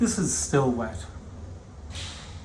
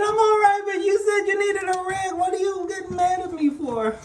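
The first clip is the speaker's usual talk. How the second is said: put-on high whiny childish voice, much higher; helper's voice. Boss mimicking the helper's whiny reply in a high voice. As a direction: whine the excuse like a clueless kid.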